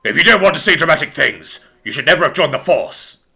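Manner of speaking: stern, commanding put-on voice; gruff angry superior; angry bark. A narrator's reading. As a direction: bark a reprimand. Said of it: "barked an angry voice".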